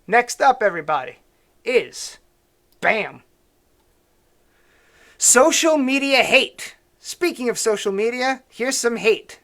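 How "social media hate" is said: slow, loud, high announcer call; segment announcement. Acted. Host introducing the next segment to viewers, slow and loud. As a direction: belt out the title like a show host.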